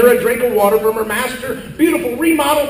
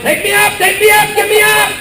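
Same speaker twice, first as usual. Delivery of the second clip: high, insistent repeated shout; Grandma's mock yell; 8 semitones higher, 3 dB louder than usual. Grandma yelling commands at the lifter, mock yell. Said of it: "after she yelled".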